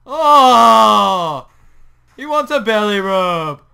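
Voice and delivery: high loud delighted cry; delighted squeal. Real, not acted. Turtle flips over.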